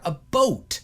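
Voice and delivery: "a boat" for "about"; Newfoundland "about". Shown as a demonstration. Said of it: "you're actually hearing is probably more".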